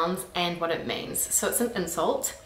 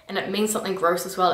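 ordinary delivery

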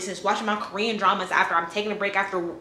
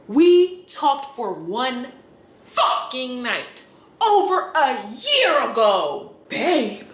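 slow, louder, half-laughing outrage; outraged disbelief; 3 semitones higher, 4 dB louder, much slower than usual. Real, not acted. Woman venting about a stranger's text, slow laughing outrage. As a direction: spell out the absurdity slowly.

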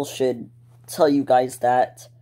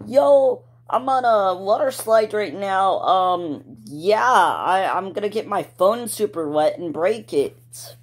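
much higher, hyped vlogger greeting to camera; excited YouTuber voice talking into his phone; 6 semitones higher than usual. Pretend. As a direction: pitch it up like a vlogger filming himself. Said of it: "like I'm recording".

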